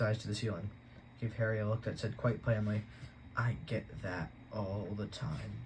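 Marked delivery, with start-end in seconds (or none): 3.4-5.6 softer, slow, tired creature voice; a fed-up old snake sighing it out; weary snake. Acted.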